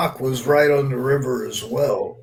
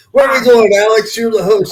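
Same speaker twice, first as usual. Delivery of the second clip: louder and higher, playful prod; mock impatient call-out; 8 semitones higher, 8 dB louder than usual. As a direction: nudge the host to get moving. Teasing co-host who stalled.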